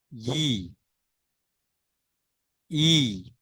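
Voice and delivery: each syllable drawn out alone, long pauses; slow syllable drill. Shown as a demonstration. Teacher showing the ya barakhadi, slow and clear. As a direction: chant each ya syllable slowly for the class. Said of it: "अब य की बारह खड़ी कैसे बनाएंगे?".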